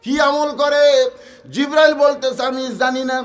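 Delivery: high-pitched sermon storytelling; impassioned preaching. Acted. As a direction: preach the question out to the crowd. Preacher at full force.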